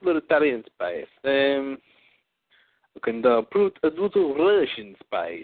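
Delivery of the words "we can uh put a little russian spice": mock Russian, slow, more Transylvanian; Russian accent. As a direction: do a Dracula-flavoured Russian. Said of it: "a little Transylvanian than Russian".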